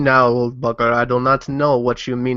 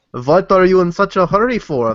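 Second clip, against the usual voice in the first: raised, higher call across the clearing; 7 semitones higher, 5 dB louder than usual. Acted. Shield maiden calling out to the old woodcutter, loud hail. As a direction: yell it out to the man. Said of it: "yell out to the man".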